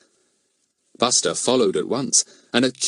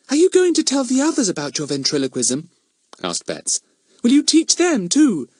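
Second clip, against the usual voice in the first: put-on high, eager little girl; girlish pleading question; young girl voice; 13 semitones higher, 3 dB louder than usual. A narrator's reading. Betts voiced.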